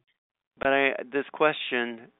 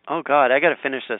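ordinary delivery

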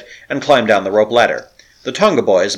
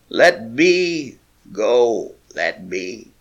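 thick, lifeless, fading to almost nothing; dead throat voice; much slower than usual. A narrator's reading. Tortured sailor warning them off, lifeless voice. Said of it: "thick and lifeless, as though forced from a dead throat".

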